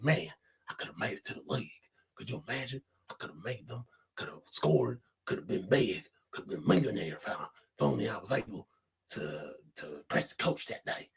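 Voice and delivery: very low, hushed, wistful murmur; Uncle Rico. Acted. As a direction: brood quietly over lost glory days. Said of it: "that's how Rico sound when he's talking".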